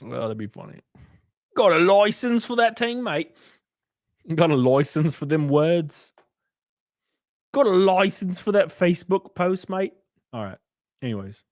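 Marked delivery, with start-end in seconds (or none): 1.5-9.9 put-on British "mate" voice; cartoonish British accent; mock British accent. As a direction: challenge them in a hammed-up British accent. Mock British policing catchphrase, repeated.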